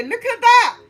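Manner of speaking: high, loud thrilled exclamation; excited. Real, not acted. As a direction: point at it, thrilled. Excited at the card.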